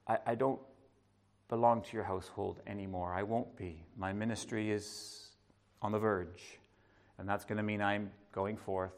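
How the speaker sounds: low, soft and kind; Jesus gently telling his mother he is leaving; gentle farewell. Pretend. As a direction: break it to her gently. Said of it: "that gentle process".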